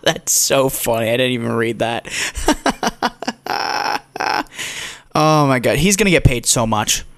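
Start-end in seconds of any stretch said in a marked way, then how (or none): 2.1-5.1 breathy cackling burst of laughter; hearty laugh. Real, not acted. Cracking up at the stat he didn't read, hearty laughter. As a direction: crack up helplessly.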